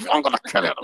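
high, exasperated outburst; exasperated chef. Retold or imitated. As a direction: lose patience with the order. Chef's exasperation in retold scene.

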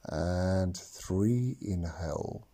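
slow counting, whispered on exhales; slow breath counting. Acted. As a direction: count each breath calmly, long pauses. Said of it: "actually count your breaths now".

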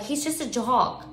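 soft, slow imitation of a babysitter; imitated sitter talking down a meltdown; babysitter's explaining voice. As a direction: explain it softly and slowly to an upset child. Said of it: "She wasn't talking quite like this".

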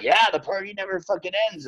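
high, fast and carefree; carefree partier. Retold or imitated. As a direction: toss it off like the party never stops. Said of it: "you're like".

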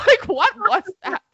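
laughing high and loud; cracking up mid-question. Real, not acted. Laughing at the bad accent.

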